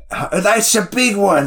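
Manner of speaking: a high, loud put-on Australian accent; an airport worker spotting a big bird, in a mock Australian accent. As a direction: play Jake with a hammed-up Australian accent. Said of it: "I don't know how to do Jake's fucking accent".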